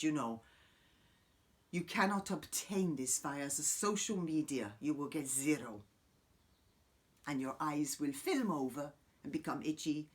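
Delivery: ordinary talk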